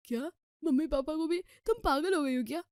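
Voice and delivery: can't believe what he's hearing; husband aghast at taking his parents along; shocked disbelief. Acted. Rahul shocked by suggestion.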